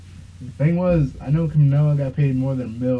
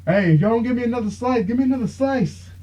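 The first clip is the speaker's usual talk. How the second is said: mock demanding fighter, pitched higher; imitating Jorge Masvidal; 9 semitones higher than usual. Fighter demands more pizza at the interview, hammed up higher. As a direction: ham up the cocky fighter at the press conference. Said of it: "And then he's like".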